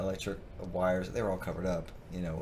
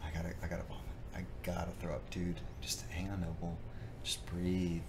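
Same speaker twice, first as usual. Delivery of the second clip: quiet; 8 dB quieter than usual. Retold or imitated. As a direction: murmur it under the breath. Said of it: "I kept telling them".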